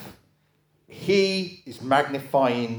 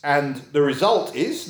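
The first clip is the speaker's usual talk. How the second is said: usual speaking voice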